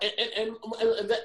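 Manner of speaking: stammering, snagged on "and"; mock stutter. Someone arguing who stutters, stuck mid-sentence. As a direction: get stuck repeating the little word. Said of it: "you stutter, you be like".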